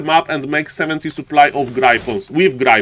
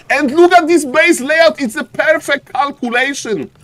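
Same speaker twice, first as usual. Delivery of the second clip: excited marvel; 9 semitones higher than usual. Real, not acted. Caster marvels at base layout.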